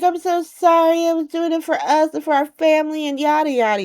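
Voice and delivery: high-pitched, put-on pleading; mock pleading apology. Brooklyn begging Chase for forgiveness, high mocking plea. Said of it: "Brooklyn is so embarrassing. She's like".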